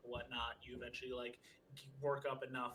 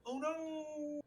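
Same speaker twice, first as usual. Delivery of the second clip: high, sudden alarmed cry; mock alarm; 14 semitones higher, 3 dB louder, much slower than usual. Real, not acted. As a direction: yelp it as if a friend vanished. Friend dropped from call.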